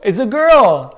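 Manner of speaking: high, loud laughing exclamation; laughing excitedly. Real, not acted. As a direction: burst out laughing. Delighted guess confirmed.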